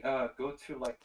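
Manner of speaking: ordinary talk, nothing marked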